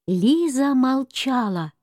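plain reading voice

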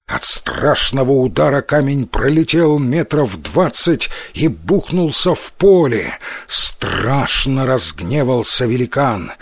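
ordinary delivery, nothing marked

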